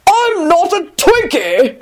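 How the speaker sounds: slow, high-pitched indignant bellow. A narrator's reading. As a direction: bellow in drawn-out protest. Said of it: "he bellowed loudly".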